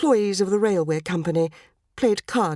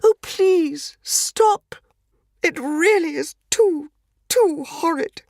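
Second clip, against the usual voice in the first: much higher, louder, faint with distress; genteel horror; 9 semitones higher, 4 dB louder, much slower than usual. Acted. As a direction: beg him to stop, a lady near swooning. Lady begs detective to stop.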